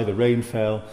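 normal talking voice

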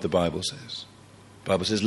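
usual speaking voice

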